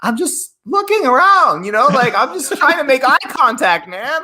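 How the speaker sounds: very high, loud squeaky protest; mock pleading. Teenager protesting to aggressive Boston kids, high mock plea. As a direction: plead innocence in a high whine.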